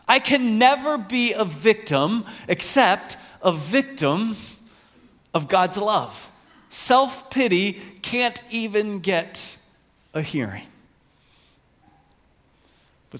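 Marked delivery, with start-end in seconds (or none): 0.1-10.7 loud, high, emphatic declaration; impassioned preaching. Acted. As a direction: proclaim it forcefully, leaning on each phrase. Reads quotation at preaching force.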